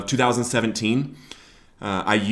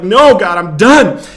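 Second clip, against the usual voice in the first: higher, much louder shout; yelling at God; 7 semitones higher, 11 dB louder, slower than usual. Retold or imitated. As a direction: yell it in anger at God. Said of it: "yelling at him".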